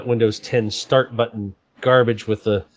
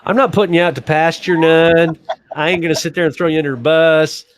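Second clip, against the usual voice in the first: raised, louder playful voice; playful teasing; 6 semitones higher, 5 dB louder than usual. Real, not acted. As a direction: tease her fondly. Teasing defense of friend.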